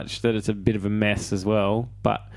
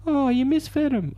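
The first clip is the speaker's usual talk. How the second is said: much higher, mock girlish line reading; high teasing imitation of a love interest; mock Michelle Williams. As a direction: squeak it out like a sweet movie girlfriend. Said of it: "She gets to say the line".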